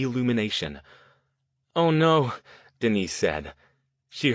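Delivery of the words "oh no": higher and louder sudden alarm; startled cry in the dark. A narrator's reading. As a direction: gasp it out as the lights die. Lights suddenly go out.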